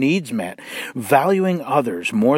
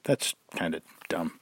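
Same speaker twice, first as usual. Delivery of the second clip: much lower, much softer aside, as if to yourself; muttered inner verdict on a bumper sticker; dismissive mutter; 8 semitones lower, 13 dB quieter than usual. Retold or imitated. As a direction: roll your eyes and mumble it. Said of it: "it's always just like".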